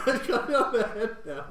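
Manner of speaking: the game master laughing at his own repeated slip. Real, not acted. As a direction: still cracking up at the slip. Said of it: "You did it again".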